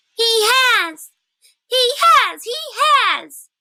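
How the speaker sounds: very high, loud, crying squeak; young squirrel losing its head. Acted. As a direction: shriek it like a terrified baby squirrel. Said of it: "it squeaked".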